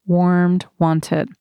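usual speaking voice

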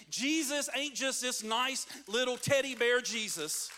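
usual speaking voice

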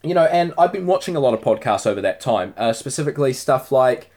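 ordinary talk, nothing marked

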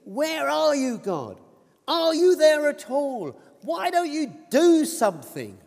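high, pleading cry to God; anguished inner scream. Acted. Believer at the end of their tether crying to God, anguished high-pitched plea. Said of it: "when inside we're screaming".

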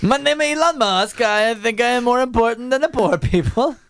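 mock-foreign impression, stretched out; put-on caricature accent; Elon Musk. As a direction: play a self-important billionaire in an odd accent. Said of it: "don't know how they talk there".

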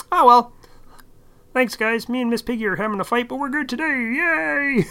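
chirpy frog sign-off with a cheer; Kermit the Frog. Acted. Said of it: "I have a tendency to do his voice".

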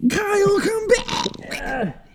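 very high, squealed fake wail; mock desperate cry. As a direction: wail after a departing friend, hammed up. Mock plea calling friend back.

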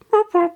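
high mock creaky door whoop; a cupboard door squeaking open. Cupboard doors swinging at the children, squeaky whoop.

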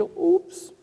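a sudden high little "Ups"; mock startled oops, pitched high. Priest recalling the cross breaking, surprised.

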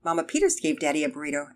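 usual speaking voice

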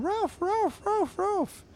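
very high-pitched cartoon dog barks; barking dog. Dog pestering Drabble in his house, high barking. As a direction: yap the words like a small dog. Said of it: "the dog's at the door going".

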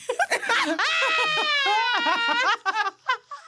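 high, loud shrieking laughter. Real, not acted. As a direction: scream with laughter. Bursts out laughing.

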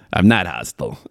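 lightly mocked Chicago accent; Kevin Bacon's Chicago accent. Podcaster quoting the film for a laugh, mock Chicago accent. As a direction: echo the film line with Chicago vowels. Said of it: "he talks like that in every movie".

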